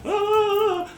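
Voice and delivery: high restrained wail; held-back scream. Acted. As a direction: keep screaming, but keep the volume down. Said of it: "one of them just keeps screaming".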